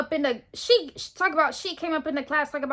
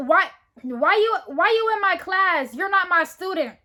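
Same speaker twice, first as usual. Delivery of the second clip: higher, louder challenge of an intruding student; confrontational teacher; 6 semitones higher, 6 dB louder than usual. Retold or imitated. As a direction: imitate a teacher confronting someone at the door.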